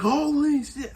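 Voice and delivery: high-pitched, drawn-out astonishment; amazed crowd reaction. Retold or imitated. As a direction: imitate a stunned crowd, pitch way up.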